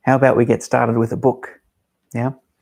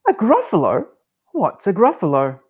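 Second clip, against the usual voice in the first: much higher startled hoot; astonished owl; 8 semitones higher than usual. Acted. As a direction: gasp it out in disbelief. Owl surprised.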